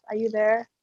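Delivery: normal talking voice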